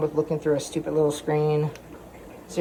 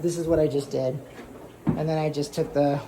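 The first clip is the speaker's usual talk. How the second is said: ordinary talk, nothing marked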